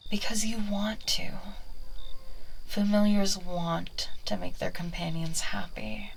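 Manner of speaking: whispered, close and persuasive; coaxing whisper. Acted. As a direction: lull the familiar with a whisper. ASMR witch whispers persuasion.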